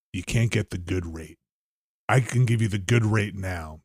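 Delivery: low, soft pushy sales voice; quiet salesman pitch. Retold or imitated. Salesman pushing his discount, low hushed mock voice.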